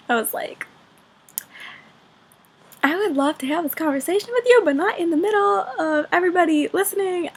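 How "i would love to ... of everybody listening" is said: high, strained polite reply; weird self-conscious voice. Retold or imitated. Student answering teacher while everyone listens, awkwardly high-voiced. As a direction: pitch it up awkwardly and hurry in. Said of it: "my voice gets all weird when there are a bunch of people listening".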